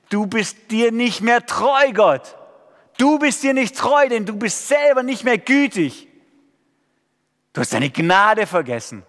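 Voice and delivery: high, loud accusing cry to God; building to a shouted plea, where are you; loud lament. Acted. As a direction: rage at God like a psalm of complaint. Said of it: "Lobpreis im Sinne vom Alten Testament geht so".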